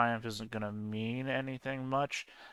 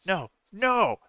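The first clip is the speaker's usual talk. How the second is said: high protesting voice; 8 semitones higher, 5 dB louder than usual. Retold or imitated. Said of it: "If he feels like".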